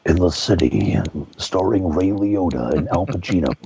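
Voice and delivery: very low, booming-style movie trailer voice; deep, dramatic trailer voice-over; Don LaFontaine. Shown as a demonstration. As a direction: announce it like an epic trailer narrator. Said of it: "that kind of movie trailer voice".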